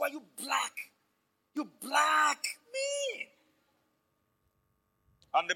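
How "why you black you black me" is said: high, sneering schoolyard taunt, pitch jumping up; mocking bully taunt. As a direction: jeer at the boy like a playground bully. Bullies taunting the boy.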